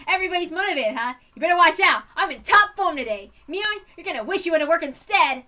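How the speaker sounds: loud, punchy swagger; cocky boast. Acted. Club leader taunting rivals before a game, cocky and loud.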